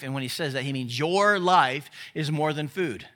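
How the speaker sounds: ordinary talk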